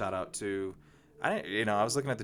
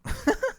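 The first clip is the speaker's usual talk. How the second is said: short high burst of laughter; quick bright laugh, high; 5 dB louder than usual. Real, not acted. Laughs at own remark.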